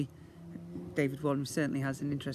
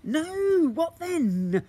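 much higher and louder, bouncing; excited guessing; 13 semitones higher, 8 dB louder, much slower than usual. Acted. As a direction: blurt each guess eagerly.

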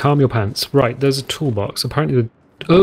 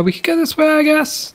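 high silly voice. Real, not acted.

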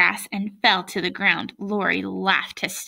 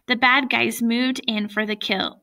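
usual speaking voice

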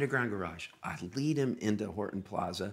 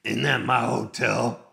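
slow, louder impression of the singer; 6 dB louder than usual. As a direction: draw the question out loudly in a put-on voice. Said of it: "and he goes like this".

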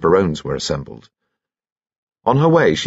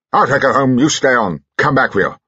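short, higher bursts of military command; brusque General; 6 semitones higher, 3 dB louder than usual. Acted. General tells his wife to stay on, brusque. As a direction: issue it in curt phrases.

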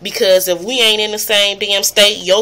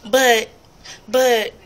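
mock stammering vlogger; 5 semitones higher than usual. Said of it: "I see videos where people is like".

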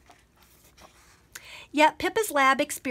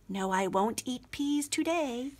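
quiet aside; 6 dB quieter than usual. Acted. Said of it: "she slipped them in her pocket".

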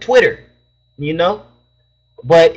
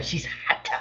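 breathy whisper under the breath; a secret, barely voiced comment; whispered aside; 14 dB quieter than usual. Real, not acted. Aside about a girl on stream.

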